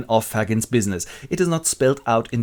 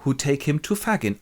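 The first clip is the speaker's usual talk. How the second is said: ordinary delivery, nothing marked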